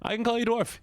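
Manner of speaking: high-pitched, energetic insistence; not sleepy. Shown as a demonstration. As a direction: pitch it way up, wide awake. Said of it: "I know you love sleepy voice".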